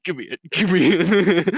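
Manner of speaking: high, loud mock begging through laughter; laughing eagerness. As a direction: imitate an eager grab for a gift, laughing. Acting veteran eager for rifle, laughing.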